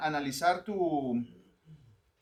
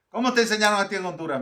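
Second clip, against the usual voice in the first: teasing colleague; 4 semitones higher, 6 dB louder, much faster than usual. Retold or imitated. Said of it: "me dijo una vez".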